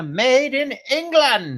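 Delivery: high, slow mock British "made in England". Husband reading the teacup mark in mock British. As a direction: ham up a British accent, drawn out.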